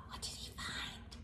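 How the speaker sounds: breathy hushed question; whisper. Real, not acted. Teller asks children in hushed voice.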